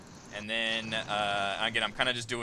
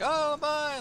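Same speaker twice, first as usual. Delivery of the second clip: high mock crowd scream. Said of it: "crowd screaming".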